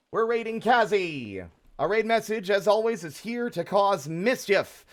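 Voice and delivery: upbeat streamer announcer; excited raid announcement. Real, not acted. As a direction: hype up the raid.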